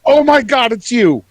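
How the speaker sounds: high, loud shocked gasp of recognition; startled recognition. Acted. Goblin noble recognizing Dirk, loud and high with shock. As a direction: blurt it out, caught off guard.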